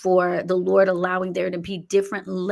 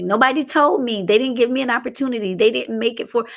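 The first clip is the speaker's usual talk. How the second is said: higher, louder mock protest; making excuses to God; 5 semitones higher, 4 dB louder than usual. Coach voicing people making excuses before the Lord, mock protesting. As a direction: plead your excuses in a raised, put-on complaint. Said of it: "go up there and say".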